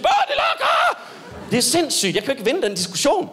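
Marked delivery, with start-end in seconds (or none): 0.0-1.0 very high laughing yell; child's shriek. Retold or imitated. Comedian imitating the girls again, high laughing shriek. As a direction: shriek the word through laughter. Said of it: "Og så lige pludselig".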